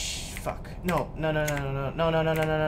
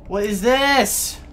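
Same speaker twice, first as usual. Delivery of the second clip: slow, high, loud alarm; loud, slow alarmed question; 8 semitones higher, 9 dB louder, much slower than usual. Real, not acted. Louder slow repeat.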